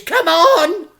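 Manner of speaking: high, loud urgent call; shout. Acted. Crook Fingers urges them into the car, a loud high shout. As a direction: hurry them up.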